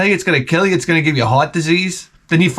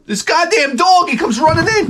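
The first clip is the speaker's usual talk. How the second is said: alarmed announcement; 13 semitones higher than usual. Retold or imitated. Comic telling how the dog ran for the die, high mock alarm. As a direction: sound the alarm as the dog charges in.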